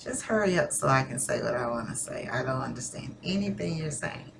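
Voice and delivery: low-key inner thought, softly spoken; soft, under-the-breath impatience; impatient thought. Acted. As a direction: voice a private thought, quietly. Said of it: "I may be thinking".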